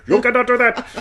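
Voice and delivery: very high, loud cartoonish voice; Invisible Rashid. Shown as a demonstration. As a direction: blurt it in a high put-on squawk. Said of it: "It's like Invisible Rashid".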